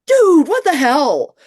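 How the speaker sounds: high, loud, slow disbelief; a dragged-out what-the-hell; incredulous outrage. Retold or imitated. Reading the letters in disbelief, high and loud.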